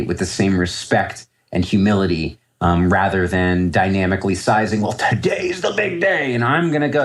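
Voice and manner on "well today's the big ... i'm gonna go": mock cocky trader. Mocking an overconfident trader.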